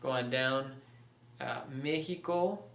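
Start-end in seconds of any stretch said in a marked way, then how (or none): 1.8-2.6 Mexico said the Spanish way, ME-hi-co; stress on first syllable. Shown as a demonstration. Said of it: "Put the stress mark on that initial syllable".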